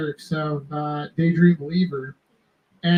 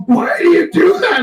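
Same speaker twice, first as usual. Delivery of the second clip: much higher, louder mock shout at the customer; frantic store lady; 12 semitones higher, 5 dB louder than usual. Diamond store lady confronting the teller, much higher mock shout. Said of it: "So she's like".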